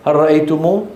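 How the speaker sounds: normal talking voice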